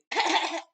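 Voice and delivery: loud burst of harassing coughs; pointed cough. Shown as a demonstration. Strangers at the gas station glaring, loud pointed cough. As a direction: cough loudly right at them. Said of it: "glaring at you".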